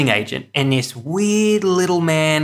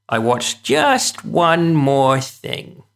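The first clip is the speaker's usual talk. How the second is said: slow, teasing reveal; drawn-out tease; 2 semitones higher, much slower than usual. Real, not acted. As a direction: draw it out for suspense. Teasing build-up before reveal.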